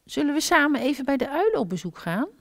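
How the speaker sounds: high, fast little hedgehog voice; quick high childlike question; little hedgehog asking. A narrator's reading. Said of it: "vroeg de kleine egel".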